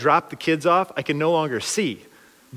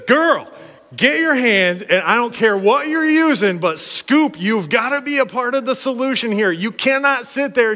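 higher, louder scolding; yelling at girl; 7 semitones higher, 3 dB louder than usual. Retold or imitated. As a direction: bark orders at the idle girl. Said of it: "when I yelled at that girl".

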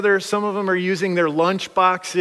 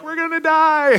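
high-pitched wail, much higher than usual; mock panicked kids; 11 semitones higher, 4 dB louder than usual. Kids to the boat driver speeding across water, mock panicked wail. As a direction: cry out like kids in mock terror.

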